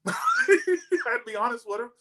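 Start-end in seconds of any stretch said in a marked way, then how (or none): 0.2-1.1 laugh. Real, not acted. Admitting he skips church.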